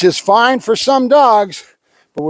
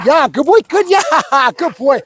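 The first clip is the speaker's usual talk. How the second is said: higher, excited praise breaking into laughter; delighted praise; 6 semitones higher than usual. Real, not acted. Trainer cheering his dog's success, laughing delighted praise.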